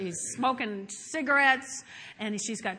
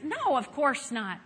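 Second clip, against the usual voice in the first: usual speaking voice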